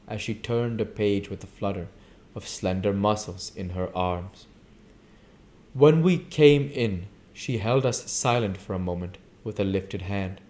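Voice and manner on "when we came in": ordinary delivery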